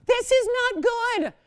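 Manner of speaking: loud and high, begging for a bid; loud high pleading for a bid; excited pleading. Real, not acted. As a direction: push the room, almost desperate. Auction host pleading for bids.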